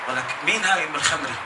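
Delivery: low, soft nudging question; hushed aside. Retold or imitated. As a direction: whisper it to your brother. Asks brother about pretty girl.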